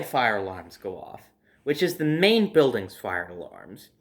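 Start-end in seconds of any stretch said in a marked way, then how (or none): none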